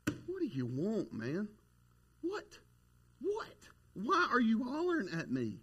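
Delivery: soft, exasperated voice for a put-upon dog; disgusted. Retold or imitated. Dog answers his hollering owner, mock disgust. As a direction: imitate a dog fed up with its owner, pitch jumping on the whats. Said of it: "he was disgusted at me".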